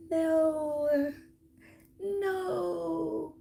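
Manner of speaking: squeaky high comic protest; Kevin Hart voice. Retold or imitated. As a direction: play a comedian's shrill no. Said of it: "In my Kevin Hart voice".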